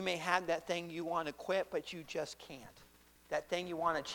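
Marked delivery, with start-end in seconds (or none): none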